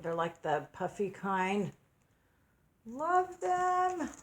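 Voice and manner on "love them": high, gushing squeal of love; squealing delight. Real, not acted. Mom opening gifts from daughter, squealing delight. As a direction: coo it like a delighted fan.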